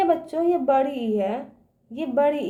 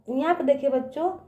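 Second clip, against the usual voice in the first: normal talking voice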